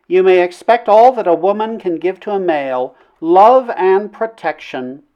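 princess lingering sweetly over each word; wooing princess, tender. A narrator's reading. Princess offers him her love.

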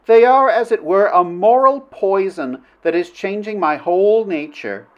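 high-pitched princess voice; troubled princess. Acted. Princess answers Flynn's fearful words, troubled. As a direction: protest as a woman being swayed.

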